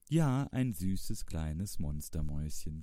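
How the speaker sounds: ordinary delivery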